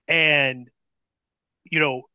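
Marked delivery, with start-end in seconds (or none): none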